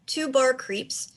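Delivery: ordinary talk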